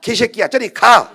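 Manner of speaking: loud mock swear with a laugh; joking scold. Tired father jokingly cursing his son away, laughing. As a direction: shoo him off, laughing as you curse.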